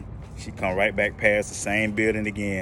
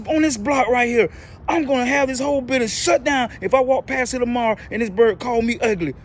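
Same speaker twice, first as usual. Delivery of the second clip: high, loud raging threat; furious tirade; 5 dB louder than usual. Retold or imitated. Angry lady threatening the manager about the parrot. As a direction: let rip at the manager. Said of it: "The lady was mad".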